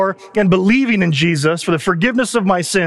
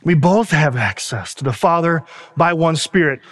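preaching. Real, not acted. Preaching the gospel.

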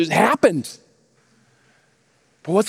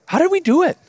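amazed wonder; 9 semitones higher, faster than usual. Retold or imitated. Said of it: "Michael says this".

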